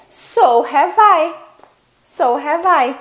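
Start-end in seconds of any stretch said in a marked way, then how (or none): 0.1-1.3 slow demo. Shown as a demonstration. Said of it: "Então, você pode responder".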